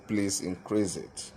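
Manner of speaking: ordinary delivery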